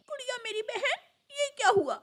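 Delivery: high, acted tearful boy voice; tearful concern. Brother seeing his injured sister, tearful. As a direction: cry out at her injuries. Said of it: "रोने लगता है".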